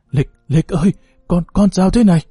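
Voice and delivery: panicked cry. A narrator's reading. Mother-in-law finds daughter-in-law unconscious, panicked shout. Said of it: "hốt hoảng la lên".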